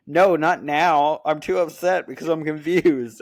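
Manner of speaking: imitating a sulking partner; mock pouting refusal. Girlfriend quoted refusing.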